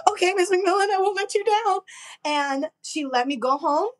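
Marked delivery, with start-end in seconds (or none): 0.1-1.8 put-on high, earnest kid's promise; eager young student. Student promising her teacher, high eager voice. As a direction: promise it brightly like a grateful kid. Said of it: "And I was like".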